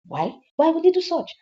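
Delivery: high, indignant demand; indignant mother demanding a reason; indignant questioning. Acted. Mother questioning son's act.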